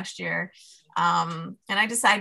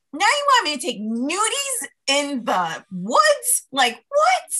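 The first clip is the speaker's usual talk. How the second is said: incredulous; 7 semitones higher, 3 dB louder, slower than usual. Retold or imitated. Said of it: "And I was like".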